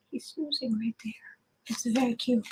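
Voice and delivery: whispered so the sleeping pet stays asleep; soft unvoiced whisper over a dozing pet; hushed whisper. Real, not acted.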